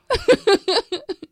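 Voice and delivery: squealing laugh; high laugh. Real, not acted. Laughs at text.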